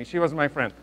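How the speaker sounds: ordinary talk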